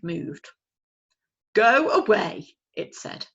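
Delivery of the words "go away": loud, slow owl's grumble; grumpy father owl. Acted. Father owl grumbling at his chick. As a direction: send the chick away.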